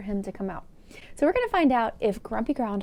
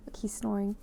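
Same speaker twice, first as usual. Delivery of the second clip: ordinary delivery, nothing marked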